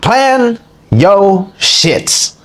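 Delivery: very slow, drawn-out command; slow emphatic. Real, not acted. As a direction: stretch every word out.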